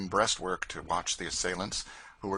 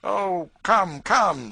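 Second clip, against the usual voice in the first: much higher and louder, placating; puffy officer; 12 semitones higher, 11 dB louder, much slower than usual. A narrator's reading. The short puffy man to George, placating. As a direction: soothe a rash young man.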